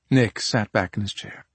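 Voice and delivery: usual speaking voice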